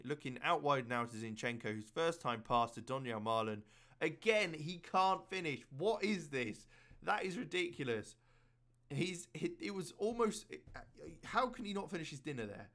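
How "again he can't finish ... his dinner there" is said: exasperated. Real, not acted. Striker misses again.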